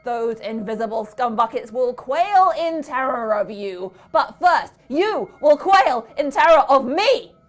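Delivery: just as loudly. A narrator's reading. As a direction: rant at the children from his steed. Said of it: "he went on just as loudly".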